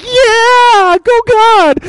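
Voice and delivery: high, loud drawn-out cheer; whooping it up, voice shooting up high; elated cheer. Acted. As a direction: cheer God on like a thrilled fan. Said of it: "You're just like".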